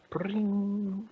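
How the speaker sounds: high-pitched ringing spell noise; ringing "pring" sound effect; magic spell zing. Acted. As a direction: trill a high magical zap.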